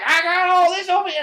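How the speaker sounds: high, loud and fast; excited. Retold or imitated. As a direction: proudly show off the arsenal. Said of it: "he's all like".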